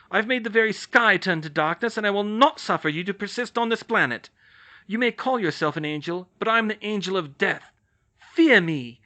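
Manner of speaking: loud, high, commanding defiance; fierce challenge. Acted. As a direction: face down the monster, full force. Hermione confronts the angel.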